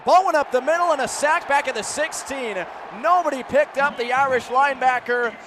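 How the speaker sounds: loud, punchy play-by-play; energetic football commentary; excited sack call. Real, not acted. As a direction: burst out with the big defensive play.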